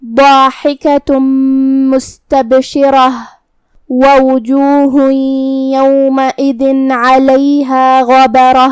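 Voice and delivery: slow melodic tajweed chant; Quran recitation. Shown as a demonstration. Teacher recites the verse in tajweed style. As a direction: draw the verse out in measured recitation.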